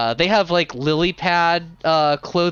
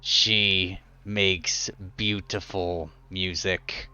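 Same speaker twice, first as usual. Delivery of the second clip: much lower, softer, slow gargoyle; 8 semitones lower, 7 dB quieter, slower than usual. Acted. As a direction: rumble it out with slow admiration.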